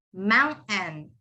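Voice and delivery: "mountain" as moun, catch, "en"; silent T. Shown as a demonstration. Teacher demonstrates the rule, silent T in mountain. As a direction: turn the ending of "mountain" into N. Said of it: "all of that turns into N".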